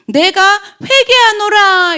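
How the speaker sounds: high, drawn-out declaration; solemn proclamation. Acted. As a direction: proclaim repentance slowly, pitched high. Acts out a solemn declared repentance. (높고 길게 끄는 엄숙한 선포조)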